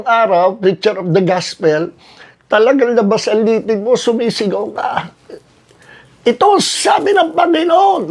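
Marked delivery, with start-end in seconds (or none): 6.3-8.1 put-on high, ringing old-style pulpit shout; preacher's shout. Shown as a demonstration. Old-time preacher without PA system shouting to the crowd. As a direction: proclaim it to the back of the crowd. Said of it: "Nagsisigaw na ganyan".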